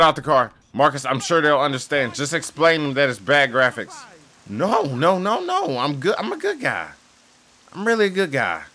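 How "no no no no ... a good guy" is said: high whiny insistence; squeaky pleading. Real, not acted. Gamer insisting to police he's a good guy.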